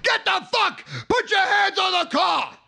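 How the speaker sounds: loud, high-pitched guard's command; shouted order. Retold or imitated. Security guards swoop on the culprits, shouting orders. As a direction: yell it like a guard making an arrest.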